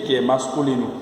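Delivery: ordinary delivery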